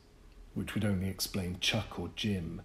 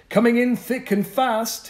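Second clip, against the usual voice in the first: put-on high, loud boyish alarm; urgent warning; 10 dB louder than usual. Boy warns Bennett of the fog like Paul Revere, urgent. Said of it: "like a tow-headed Paul Revere".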